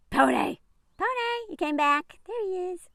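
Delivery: pet greeting. Real, not acted. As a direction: coo at the pony.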